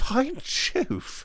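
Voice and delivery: high surprised gasp of recognition; startled by Jove; surprised exclamation. A narrator's reading. Slender man recognizes an old schoolfellow, surprised. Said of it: "said the slender man".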